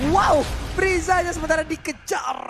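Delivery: shouting high-energy play-by-play; esports caster. Real, not acted. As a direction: scream the highlight like a caster. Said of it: "gua kan saking excitednya".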